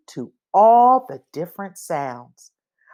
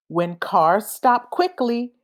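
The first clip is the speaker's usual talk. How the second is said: ordinary delivery, nothing marked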